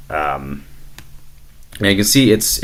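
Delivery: normal talking voice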